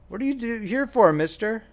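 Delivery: put-on high-pitched curious little boy. A narrator's reading. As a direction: voice a small child asking a stranger. Little boy voiced in story.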